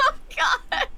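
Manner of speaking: high wheezing laughter; laughing hard. Real, not acted. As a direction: laugh through the words. Laughing fit after joke.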